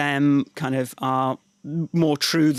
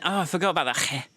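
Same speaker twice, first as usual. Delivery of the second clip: normal talking voice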